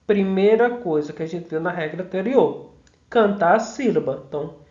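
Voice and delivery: usual speaking voice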